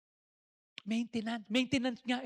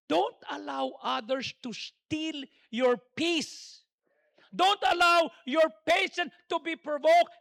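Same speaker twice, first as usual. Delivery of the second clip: slow deliberate preaching; slow emphasis; 5 semitones higher, 7 dB louder, slower than usual. Acted. Preacher urging the congregation to keep their peace, slow. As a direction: weigh every word.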